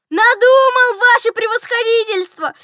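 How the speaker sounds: loud, high cry of triumph; joyful frenzied shout. A narrator's reading. Steward bursting in on the general, shouting joyfully. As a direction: shout it wildly with joy. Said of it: "закричал он радостно, не своим голосом".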